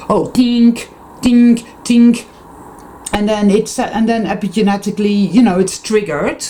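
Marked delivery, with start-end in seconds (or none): 0.1-2.3 high, light tinkling piano notes sung out; piano tinkle. Retold or imitated. Explaining genes with a mock piano tink. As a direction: play the keys with your voice, tink tink. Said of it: "It's like a piano, you know, play".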